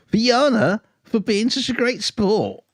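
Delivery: high and bright, slowing on sport; bright super chat read. Acted. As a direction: read the viewer's message cheerily up high. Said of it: "super chat".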